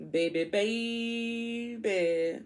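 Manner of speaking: high, slow sing-song; high, stretched-out croon; sing-song delight. Real, not acted. Delighted at the card.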